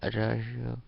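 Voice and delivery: usual speaking voice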